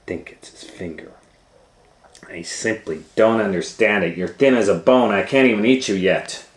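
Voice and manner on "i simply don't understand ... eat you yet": louder, exasperated witch; lightly voiced annoyed witch. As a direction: grumble in frustration. Witch complains to Hansel.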